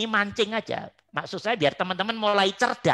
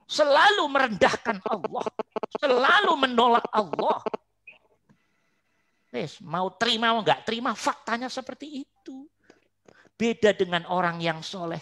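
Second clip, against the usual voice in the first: usual speaking voice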